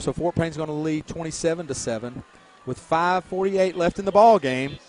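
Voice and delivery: ordinary talk, nothing marked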